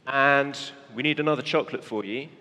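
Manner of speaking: ordinary delivery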